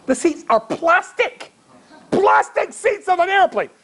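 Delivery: high-pitched, incredulous complaint; comic outrage. Real, not acted. Rabbi telling the audience about plastic airplane seats, comically outraged. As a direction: pitch leaps up in exaggerated disbelief.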